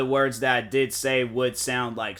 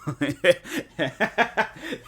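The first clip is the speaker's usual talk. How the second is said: sudden high burst of laughter; 5 semitones higher than usual. Real, not acted. Laughs at a teasing remark.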